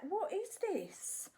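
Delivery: high, slow bewildered question; baffled. Retold or imitated. Spinning around on set, high bewildered. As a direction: ask it in high, slow disbelief. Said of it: "I'm like".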